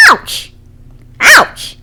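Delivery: high yelp of pain from a cat; cat crying ouch. Acted. Banjo the cat hurt by a cactus yelps. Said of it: "Banjo cried".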